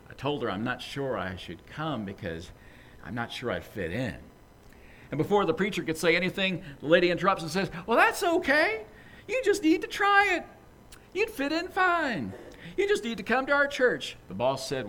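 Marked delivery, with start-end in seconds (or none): 0.1-4.2 softer, sheepish mumble; hesitant, shrinking from the invite. Acted. Boss to the pushy church lady, sheepish and softer. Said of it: "sheepishly says". 7.9-14.2 much higher, bright pushy church lady voice; eager church lady. Acted. Church lady urging her boss to come, high and eager.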